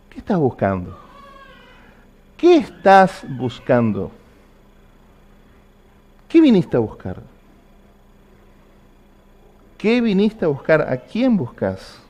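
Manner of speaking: searching questions, spaced out with weight; lightly voiced Jesus, drawing out the question to test the listener; Jesus probing. Jesus tests you by asking what you seek, probing questioning. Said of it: "Jesús va preguntándote".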